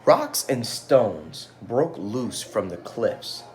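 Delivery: ordinary delivery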